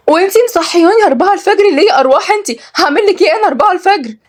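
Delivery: high, incredulous protest; shocked. Acted. Kenzi to the maid who woke her at dawn, shocked. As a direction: pitch leaps up in disbelief. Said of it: "كنزي بصدمة".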